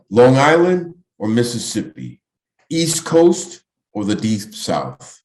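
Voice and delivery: measured poem reading, each phrase lifted then dropped; poetry recitation. Acted. Poet reading a draft-notice poem to an audience, measured recitation. Reads own poem aloud.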